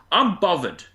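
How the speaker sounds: a lightly done cockney "I'm bothered"; Catherine Tate catchphrase. Retold or imitated. Host doing the comedy catchphrase for her co-host, cockney accent. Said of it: "If you like Catherine Tate".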